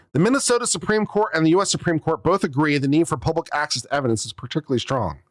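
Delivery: usual speaking voice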